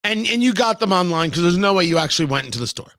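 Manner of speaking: normal talking voice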